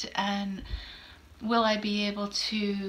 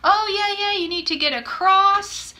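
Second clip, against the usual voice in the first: higher, louder, brisk station clerk; helpful station worker; 8 semitones higher, 6 dB louder than usual. Retold or imitated. Station worker cheerfully tells the lost traveler, higher and louder. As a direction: chirp the directions like a busy clerk. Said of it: "And she goes".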